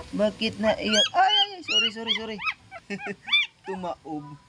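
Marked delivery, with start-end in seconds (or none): 1.2-2.4 squeaky high flustered sorries; flustered apology. Real, not acted. Owner apologising after knocking something over, squeaky flustered. 2.4-3.6 high giggle at her own clumsiness; embarrassed laugh. Real, not acted. Owner laughing after the mishap, high embarrassed giggle.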